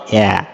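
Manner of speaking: অ্যা as a short open a sound, as in bag; vowel sound demo. Shown as a demonstration. Teacher modelling the middle vowel sound for students. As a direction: say অ্যা cleanly, alone. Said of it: "মাঝের sound কোনটা হবে?".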